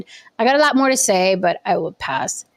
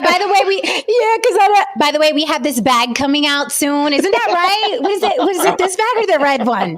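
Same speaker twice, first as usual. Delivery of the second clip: mock celebrity voice; 8 semitones higher than usual. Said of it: "She was like".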